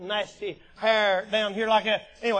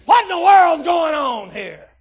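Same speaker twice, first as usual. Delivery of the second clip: loud, high, slow indignant question; drawn-out shouted challenge; David; 6 semitones higher, 12 dB louder than usual. Retold or imitated. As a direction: holler it out in disbelief. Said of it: "and he said".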